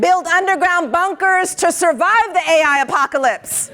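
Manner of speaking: rally the crowd with each prompt; shouted prompts. Shown as a demonstration. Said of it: "calling out prompts to audience".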